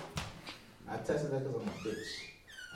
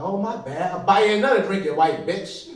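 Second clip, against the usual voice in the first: raised, loud comic comeback; mock indignant retort; 5 semitones higher, 11 dB louder than usual. Drinker offering replacement drink, mock indignant. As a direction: snap back loudly with a grudging offer.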